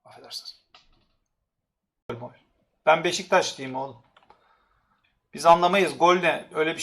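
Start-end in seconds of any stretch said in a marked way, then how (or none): 0.1-0.6 a soft breathy whispered apology. Real, not acted. Streamer whispering an apology after an outburst. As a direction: whisper sorry under your breath.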